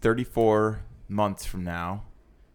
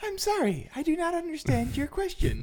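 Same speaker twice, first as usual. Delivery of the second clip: high, even voice assistant tone; Siri voice; 3 dB quieter than usual. Pretend. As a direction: answer like a phone assistant stuck on a question. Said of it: "yeah, Siri's voice".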